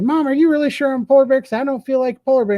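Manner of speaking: high, fast, worried little cub voice; baby polar bear. Acted. Baby bear pleading with mom in a high, quick kid voice. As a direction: pipe it up like a nervous kid.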